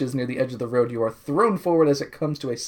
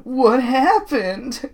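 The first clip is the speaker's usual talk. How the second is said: high, drawn-out question; Kimber; 8 semitones higher, much slower than usual. Acted. Kimber after the crash, high drawn-out alarm. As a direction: stretch it out, pitched up. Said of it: "Kimber asks".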